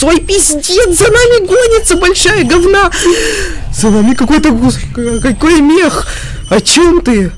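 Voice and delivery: frantic cries while fleeing; being chased. Real, not acted. Big monster chasing him.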